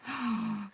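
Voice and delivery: delighted gasp. Real, not acted. Admiring her print.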